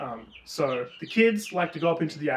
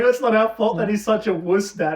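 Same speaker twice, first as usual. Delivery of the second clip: put-on higher, louder whiny kid voice; bratty kids; 6 semitones higher, 6 dB louder than usual. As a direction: sulk back at dad. Kids talk back to dad.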